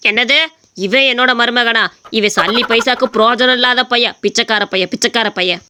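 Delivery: old father mocking the would-be son-in-law; scornful old father. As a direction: sneer at the useless son-in-law.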